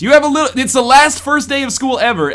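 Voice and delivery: high, loud, hyped-up narrator; punchy storybook announcer; game narration. Acted. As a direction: read it out big and bouncy.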